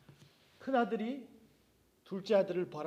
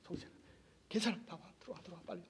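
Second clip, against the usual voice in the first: soft, lower coaxing to come in; coaxing father; 3 semitones lower, 11 dB quieter, faster than usual. Acted. Father coaxing the sulking son outside, soft and low. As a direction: gently hurry him inside. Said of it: "밖에 서서 집에 안 들어오고".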